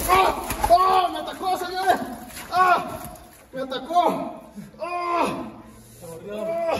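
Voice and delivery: high startled cries of pain; startled yelling. Real, not acted. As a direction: yell as the catfish bites. Fish bites him in water.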